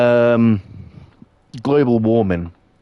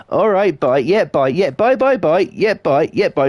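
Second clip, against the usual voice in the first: chirpy, higher, drawn-out byes tumbling over each other; mock old folks who can't hang up; imitating old people's goodbyes; 6 semitones higher than usual. Mimicking old people saying goodbye on the phone, higher and singsong. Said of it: "take half an hour to say goodbye".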